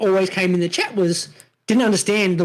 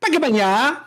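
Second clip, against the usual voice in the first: Pecco Bagnaia mashed into one word, Aussie speed; fast Australian slur; 4 semitones higher than usual. Shown as a demonstration. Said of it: "Australian accent ... speed of it".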